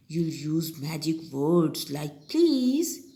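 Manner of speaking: ordinary talk, nothing marked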